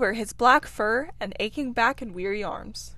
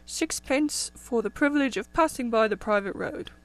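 lightly voiced old rabbit toll keeper; a hint of a fussy old rabbit; elderly rabbit. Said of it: "said an elderly rabbit".

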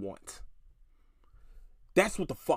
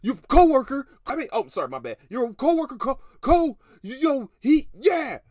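much higher, much louder whiny sputter; mocking tantrum; 9 semitones higher, 8 dB louder than usual. Mocking the coworker throwing a fit, high and loud. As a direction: parody a grown man's hissy fit. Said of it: "that's literally what he's doing".

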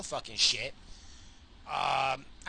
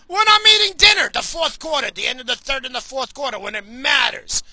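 high, loud sports-fan rant; worked-up, indignant yelling; exasperated shouting; 9 semitones higher, 11 dB louder than usual. Real, not acted. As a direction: shout the grievance at full voice. Shouted rant about dinner timing.

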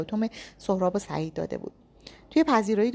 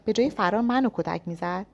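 usual speaking voice

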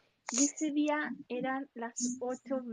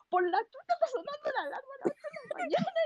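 startled outburst; 12 semitones higher, faster than usual. Retold or imitated. Waking up startled by alarm.

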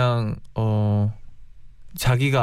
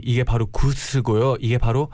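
ordinary talk, nothing marked

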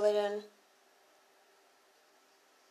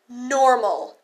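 "normal" set apart in spoken quote marks, slightly louder; air-quoted word; 6 dB louder than usual. Shown as a demonstration. Mocking the exes' idea of "normal" with spoken quote marks. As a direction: lean on "normal" as if held in scare quotes. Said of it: "quote, end quote".

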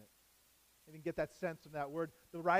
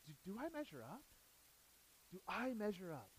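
soft, hesitant self-questioning; voice in the head; 9 dB quieter than usual. Acted. Preacher voices a listener's private self-doubt, softly. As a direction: ask it quietly, as if only to yourself. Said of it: "hear that voice in your head".

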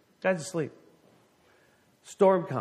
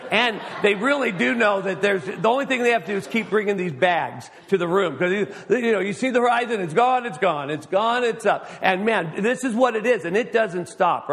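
louder, higher, rushing story; excited storytelling; 6 semitones higher, 6 dB louder than usual. Real, not acted. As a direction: build it up like rough seas. Sailing swells story, rising intensity.

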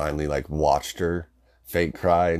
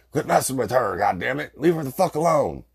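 irritated father's raised shout through the door; angry dad yelling; 8 semitones higher than usual. Retold or imitated. Dad leaning in the door to scold the kids, raised angry shout. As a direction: snap at the kids to knock it off. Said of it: "stuck his head in the door inside and was like".

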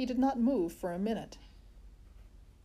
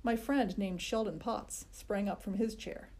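ordinary delivery

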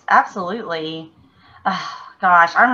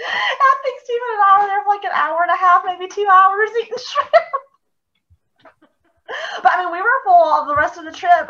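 laughing; 12 semitones higher than usual. Real, not acted.

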